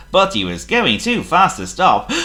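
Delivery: ordinary delivery, nothing marked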